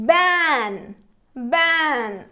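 "బ్యాన్" loud, long vowel, falling; pronunciation demo. Shown as a demonstration. Teacher modelling the blended word, "బ్యాన్" loud and drawn out. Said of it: "ఎలా పలుకుతాయో చూద్దాము".

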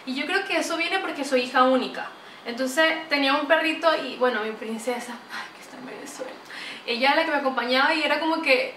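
normal talking voice

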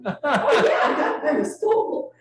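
loud laughing burst. Real, not acted. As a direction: laugh it out, high. Laughing loudly mid-story.

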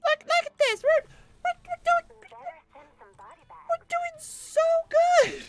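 high, loud mock-cheerful squeak; sarcastic falsetto. Player pretending the losing battle goes well, mock falsetto cheer. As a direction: chirp fake joy while losing.

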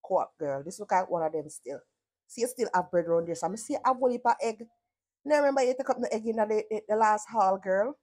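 ordinary talk

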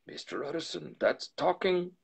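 high-pitched, eager exclamation; butler's cry of approval. A narrator's reading. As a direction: exclaim it keenly, pitched well up. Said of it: "cried the butler".

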